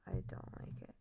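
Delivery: hushed whisper; whispered aside to the chat. Real, not acted. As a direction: drop to a breathy whisper.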